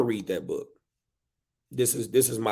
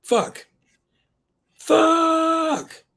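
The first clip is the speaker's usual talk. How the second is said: building frustrated yell; 7 dB louder than usual. Acted. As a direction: curse rising to a high, loud, drawn-out cry. Book's curse builds to a scream.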